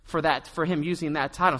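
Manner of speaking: normal talking voice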